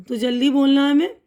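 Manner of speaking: ordinary delivery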